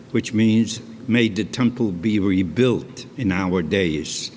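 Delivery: ordinary delivery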